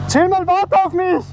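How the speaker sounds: high, slow call after him. Real, not acted. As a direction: call after someone driving off. Calling after driver who leaves.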